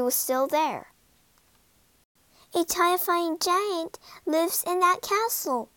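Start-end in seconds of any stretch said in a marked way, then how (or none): 2.5-5.7 higher-pitched storybook fairy warning; mild warning. A narrator's reading. Fairy cautions Jack about the castle, mild warning. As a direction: warn him in a higher voice. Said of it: "warned the fairy".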